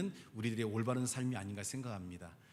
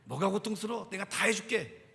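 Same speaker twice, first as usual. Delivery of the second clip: higher-pitched, emphatic offer of help; take-charge reassurance; 7 semitones higher, 4 dB louder than usual. Shown as a demonstration. Preacher showing congregants how to take charge of a neighbour's pain, emphatic reassurance. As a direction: model an eager, take-charge promise. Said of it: "덤벼들어야 돼요".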